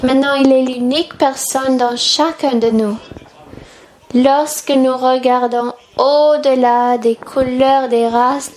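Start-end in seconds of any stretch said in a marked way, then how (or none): none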